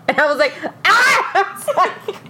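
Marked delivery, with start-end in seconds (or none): 0.8-1.0 short high re-enacted shriek; sudden yelp of a scream; excited scream. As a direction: let out a quick mock scream. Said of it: "I start screaming".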